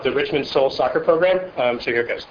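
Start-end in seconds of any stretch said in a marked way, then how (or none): none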